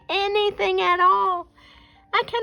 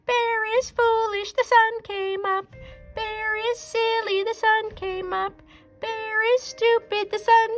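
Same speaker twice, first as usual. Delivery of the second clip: very high mocking squirrel singsong; teasing. A narrator's reading. Squirrel taunts Bear about the sunrise, high mocking singsong. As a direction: taunt him like a cheeky little squirrel. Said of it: "began to tease Bear".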